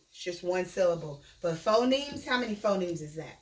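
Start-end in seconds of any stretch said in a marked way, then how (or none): none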